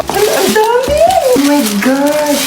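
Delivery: high, then slow drawn-out awe; overjoyed at the pile of cake; excited squeal. Acted. Child sees many cheesecakes.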